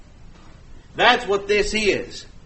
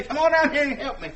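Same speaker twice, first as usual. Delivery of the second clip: higher, urgent call for help; lightly voiced call to a friend; calling out to a buddy; 7 semitones higher than usual. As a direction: call across the water for help. [name] calling his buddy.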